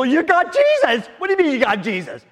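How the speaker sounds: high, loud, then fast disbelief; surprised reply. Retold or imitated. Preacher reacting to friend's news, amazed high voice. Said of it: "they go".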